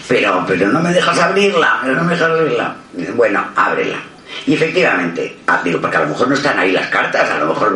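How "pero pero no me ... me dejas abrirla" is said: higher-pitched pleading protest. Retold or imitated. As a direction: insist as if denied something you badly want. Retells her protest to shaman. (protesta suplicante)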